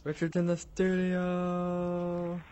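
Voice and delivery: slow, high stretched announcer voice; drawn-out announcer. Acted. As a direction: stretch every word like a game show host.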